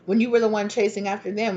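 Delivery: normal talking voice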